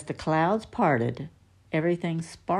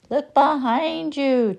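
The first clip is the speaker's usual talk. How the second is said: high, louder, slow shout; shouting again; 10 semitones higher, 5 dB louder, slower than usual. Acted. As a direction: really yell it the second time. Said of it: "Tigger shouted again".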